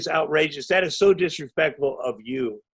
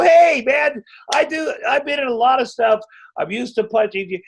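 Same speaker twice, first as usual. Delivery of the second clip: shouted reassurance; 7 semitones higher than usual. Retold or imitated. Shouting at the nervous actor that he knows how to fake a punch. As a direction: yell it to calm a nervous co-star. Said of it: "I go".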